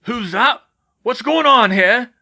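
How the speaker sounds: loud, high, drawn-out call; gruff stranger calling out. Acted. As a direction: holler a challenge from a distance. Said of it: "a voice called".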